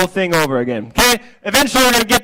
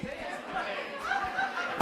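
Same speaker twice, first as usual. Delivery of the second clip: squeaky silly voice; 20 dB quieter, much slower than usual. Acted. Silly dance call.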